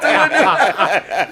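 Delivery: slow, loud shout through laughter; laughing yell. Retold or imitated. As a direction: drag it out loud while cracking up. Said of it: "I yell out".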